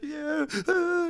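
high-pitched whimper; badly faked sobbing answer; mock crying. Hurt boy to worried cousins, mock tearful whimper. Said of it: "like, crying".

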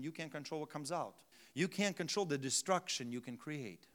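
ordinary delivery

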